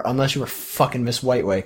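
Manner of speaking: usual speaking voice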